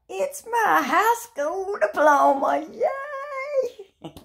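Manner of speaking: high, loud, drawn-out thrilled reveal; excited cheer. Real, not acted. Showing her diploma, cheering high and loud with joy. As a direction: cheer it out with pure delight.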